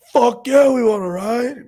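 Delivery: loud, high, whooping reply; imitating an eager yelled answer; excited holler back. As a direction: shout it back like a rowdy drunk. Retold passenger hollers back eagerly.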